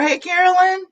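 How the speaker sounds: ordinary talk, nothing marked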